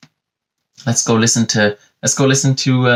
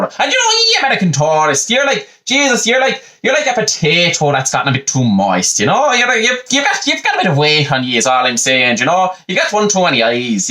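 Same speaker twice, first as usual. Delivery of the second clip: much higher, jolly paddywhackery Irish comedian voice; hammed-up stage Irishman; 8 semitones higher than usual. Mocking Irish comedian talks to American tourists in a high, hammed-up brogue. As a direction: ham up a twinkly Irish charmer for the tourists. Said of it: "And he'll go".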